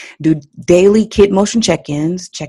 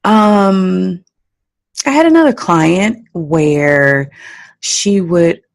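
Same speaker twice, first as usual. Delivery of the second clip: ordinary delivery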